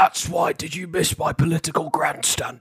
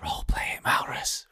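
hushed urging whisper; 7 dB quieter than usual. Real, not acted. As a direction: whisper it so others miss it. Urging a player in secret.

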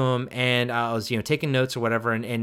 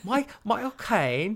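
Michael Caine impression; 8 semitones higher than usual. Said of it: "School of Acting with no blinking".

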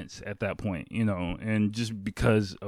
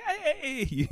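high falsetto greeting; mock-giddy heys, very high; so happy; 3 dB quieter than usual. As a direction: imitate a delighted friend calling hello. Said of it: "like, so happy".

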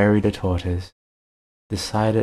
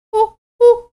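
high hooting owl voice; 11 dB louder than usual. Acted. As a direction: hoot it like a wise owl.

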